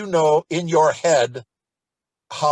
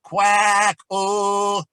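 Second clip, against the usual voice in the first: obnoxious piercing quack; 6 semitones higher, 6 dB louder than usual. Shown as a demonstration. Teacher demonstrating the obnoxious quack drill, piercing and loud. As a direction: Quack ah much higher and louder, piercing. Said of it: "it sounds obnoxious".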